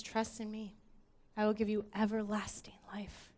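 whispered words of Jesus. Acted. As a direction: breathe it like a secret promise. Quoting Jesus softly.

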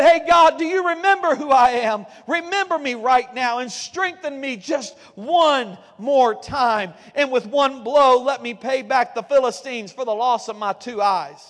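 loud, pleading call upward; desperate cry to God. Retold or imitated. Samson's prayer voiced loudly.

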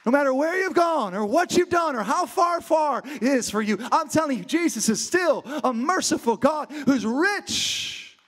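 impassioned preaching with drawn-out key words. Real, not acted. Preacher promising the room Jesus still wants them, impassioned. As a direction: drive it home, stretching the big words.

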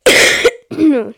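loud sick cough; real cough. Real, not acted. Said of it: "ich huste die ganze Zeit".

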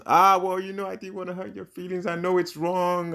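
high-pitched, hammed-up apology; dramatized excuse. Amma excuses herself to wronged Sarah, dramatized apology. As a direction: plead innocence in a put-on high voice. Acting the friend's guilty excuse.

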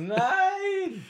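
very high, loud drawn no; shocked squeal. Real, not acted. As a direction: squeal in pure disbelief. Schriller Ausruf. (schriller Schockschrei)

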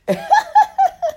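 very high squealing giggle. Retold or imitated. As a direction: giggle like a girl. Laughs in character.

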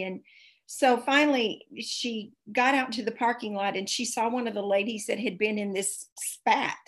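ordinary talk, nothing marked

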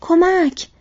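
high, loud shout; shouted plea, pitched well up; urgent cry for help. A narrator's reading. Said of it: "فریاد زدند".

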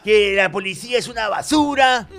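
mock angry rant. Friend ranting at police, hammed-up high indignant shout. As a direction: rant at the cops like a spoiled kid. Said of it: "el huevón se encrespó".

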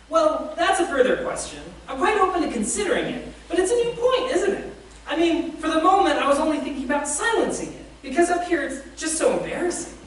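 flustered evasive ghost. A narrator's reading. The ghost stalls the angel, high and nervously evasive. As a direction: squirm out of it, voice climbing high.

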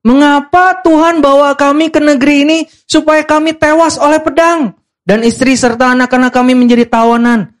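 high, hammed-up lament; melodramatic. Israelites blame God for their plight, melodramatic. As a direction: overblown accusing wail. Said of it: "Lebay banget".

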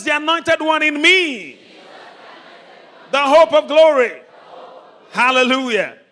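high, very loud preacher's proclamation; full-force declaration. Real, not acted. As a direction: call out each phrase for the crowd to repeat. Leads loud declarations for congregation.

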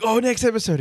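high, eager burst of hype; excited. Retold or imitated. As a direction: gush about the next episode. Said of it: "I'm like".

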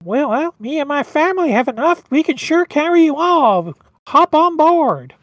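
high, loud nasal reindeer; whiny, nasally Rudolph. Acted. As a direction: invite them aboard in a whiny cartoon voice. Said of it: "he's got that kinda really whiny, nasally voice".

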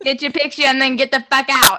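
put-on brusque, playful Boston-accented order. Sherpa rushing climbers off the summit, mock Boston accent. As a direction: play a rude Bostonian moving people along. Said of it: "I love a Sherpa with a Boston accent".

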